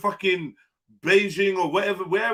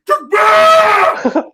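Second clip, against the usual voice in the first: a high, much louder shouted Takbir!; imitated war cry; 13 dB louder than usual. Mocking the imam's call, shouted high and loud. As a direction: bellow "Takbir!" like a battle call. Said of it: "Why do they say it in that voice as well?".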